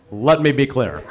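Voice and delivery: low-voiced presidential punchline; Barack Obama. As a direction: drop low to imitate a politician's catchphrase. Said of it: "he would always use that phrase".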